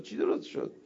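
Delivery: unvoiced puzzled whisper to a neighbour; confused whispered question. Puzzled listener whispering to his friend, unvoiced. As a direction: ask it under your breath, lost.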